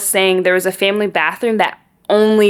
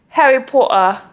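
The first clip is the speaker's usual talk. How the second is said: put-on British accent; 5 semitones higher than usual. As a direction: say Harry Potter with a British accent. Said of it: "do it in a British accent".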